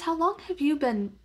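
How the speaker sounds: ordinary talk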